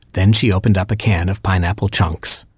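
ordinary delivery, nothing marked

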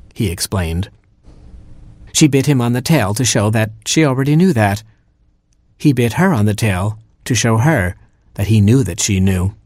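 plain reading voice

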